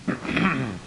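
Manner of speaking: quick throat clear. Real, not acted. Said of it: "a bit of a frog in my throat".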